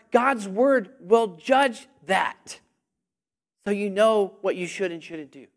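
ordinary delivery

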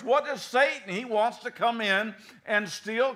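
ordinary delivery

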